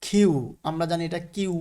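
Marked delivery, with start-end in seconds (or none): 0.0-0.3 কিউ as "khyu", with a puff on the k; careful model of কিউ; correct Q pronunciation. Shown as a demonstration. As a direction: say কিউ with an aspirated k. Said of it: "কিন্তু এটা আসলে কি?".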